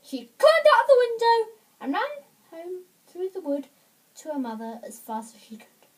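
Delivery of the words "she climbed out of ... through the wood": high, loud, excited storytelling; urgent narration. A narrator's reading. Storyteller as Goldilocks flees the bears, urgent. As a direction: tell the escape with raised urgency.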